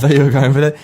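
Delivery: usual speaking voice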